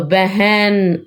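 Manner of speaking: बहन drawn out slowly, each sound clear; slow example word. Shown as a demonstration. Teacher showing the singular word, slow and clear. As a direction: spell out तो बहन slowly for the class. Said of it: "जैसे एक वचन बहन है".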